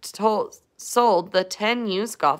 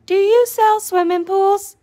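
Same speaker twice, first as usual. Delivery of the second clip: tiny worm voice; 12 semitones higher, 4 dB louder than usual. Acted. Worm Slimy voiced in story.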